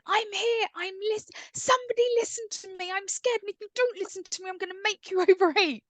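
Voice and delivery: put-on high, fast, pleading child voice; a scared little girl begging to be heard; frightened little girl. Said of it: "instead of being going".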